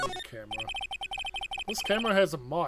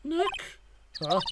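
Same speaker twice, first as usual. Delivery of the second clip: usual speaking voice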